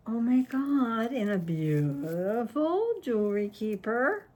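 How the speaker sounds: ordinary delivery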